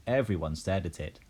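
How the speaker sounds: usual speaking voice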